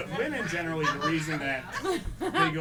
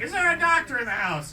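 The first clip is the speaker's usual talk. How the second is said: calling out; 11 semitones higher, 7 dB louder than usual. Retold or imitated. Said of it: "when somebody goes".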